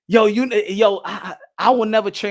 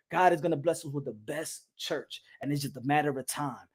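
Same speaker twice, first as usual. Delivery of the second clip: mock pastor; 8 semitones lower, 8 dB quieter than usual. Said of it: "the pastor was gonna always be like".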